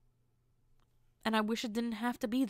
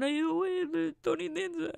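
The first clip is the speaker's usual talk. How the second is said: higher-pitched Italian-American impression; playful put-on Italian guy voice, pitched up; Tony Danza; 7 semitones higher than usual. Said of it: "sometimes do Tony Danza's voice".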